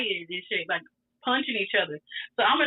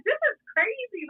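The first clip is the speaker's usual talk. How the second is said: much higher, stunned disbelief; surprised; 12 semitones higher than usual. Retold or imitated. Watching the fighting couple start kissing, stunned. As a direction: react in shock at the sight.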